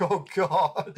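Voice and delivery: mock dismay, cracking up; laughing exasperation. Laughing at retold dream exasperation.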